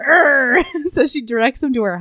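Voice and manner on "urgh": short loud retching urgh; disgusted groan. Retold or imitated. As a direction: groan like you might be sick. Sick girl groaning at him.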